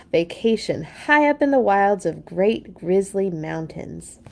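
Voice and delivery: plain reading voice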